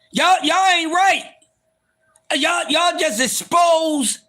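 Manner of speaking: high-pitched, hammed-up outrage; mock indignation. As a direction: scold the audience in a high, wounded protest. Mock-indignant scolding of his audience.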